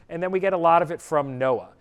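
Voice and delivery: ordinary talk, nothing marked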